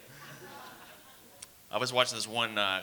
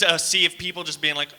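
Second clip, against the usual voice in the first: ordinary talk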